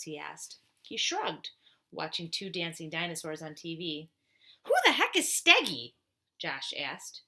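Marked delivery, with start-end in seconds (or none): 4.6-5.9 loud, high, incredulous question; baffled outburst. Acted. Josh, baffled by the name, loud and incredulous. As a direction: blurt it out in disbelief.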